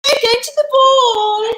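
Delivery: mean ugly sisters. Shown as a demonstration. Ugly sisters skip off to the ball, crowing. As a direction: gloat it loudly in a high voice. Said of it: "'cause you're mean, could you skip around".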